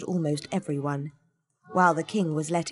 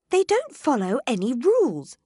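higher, louder grumble; complaining; 9 semitones higher, 6 dB louder than usual. A narrator's reading. Alice complains to the Cat, higher and louder. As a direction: grumble about the unfair game. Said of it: "she complained".

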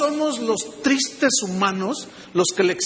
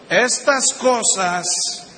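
slow emphatic reading; slower than usual. Real, not acted. Reading scripture slowly for emphasis.

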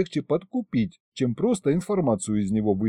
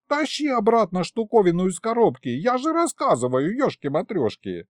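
put-on high, excitable old man; high-pitched indignant sorcerer; pushy old sorcerer, indignant; 8 semitones higher than usual. A narrator's reading. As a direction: dictate terms, then squawk in protest.

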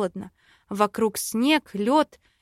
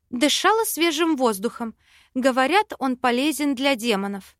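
ordinary delivery, nothing marked

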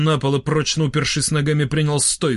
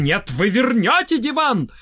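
high, drawn-out insistent cry; shouting; 8 semitones higher than usual. A narrator's reading. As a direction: call after him in high outrage. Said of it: "за ним с криком". (настойчивый крик высоким голосом)

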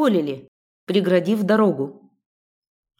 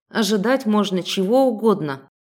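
ordinary delivery, nothing marked

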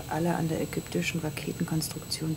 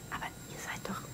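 whisper; 10 dB quieter than usual. Acted. Surprised private talk.

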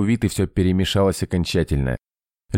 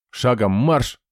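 sharp drill order; barked command; 6 semitones higher, slower than usual. A narrator's reading. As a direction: bark it like a sergeant.

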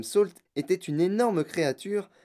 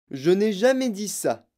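higher, emphatic denial; monsieur Wonka; 7 semitones higher, 6 dB louder than usual. A narrator's reading. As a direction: deny it in a raised voice. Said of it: "dit monsieur Wonka". (démenti appuyé, voix plus aiguë)

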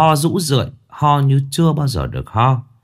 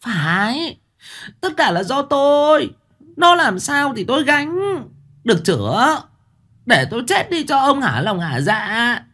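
wailing outburst; 14 semitones higher than usual. A narrator's reading. Wife lashes back at husband, high wailing voice. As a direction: cry out bitterly, pitched high. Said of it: "gào lên".

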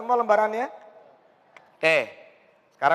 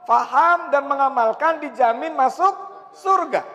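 fervent preaching; 5 semitones higher than usual. Acted. Preacher urging the congregation to memorize, fervent. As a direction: preach it out to the hall with fire.

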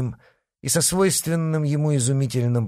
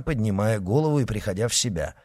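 ordinary delivery, nothing marked

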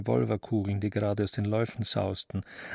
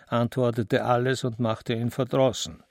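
usual speaking voice

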